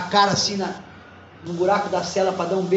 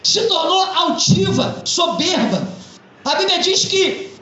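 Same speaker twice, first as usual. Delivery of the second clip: raised, ringing pulpit voice; fervent preaching; 8 semitones higher than usual. Acted. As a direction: preach it to the congregation with conviction. Pastor preaches to congregation.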